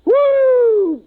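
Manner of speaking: loud high whoop; drunken whoop. Acted. Drunk crewman cheering before the mission, loud whoop. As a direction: let out a wild drunken whoop.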